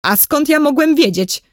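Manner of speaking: high-pitched defensive question; flustered self-justification; making excuses. A narrator's reading. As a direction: protest your innocence, pitch jumping up. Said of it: "zaczął się tłumaczyć oficer".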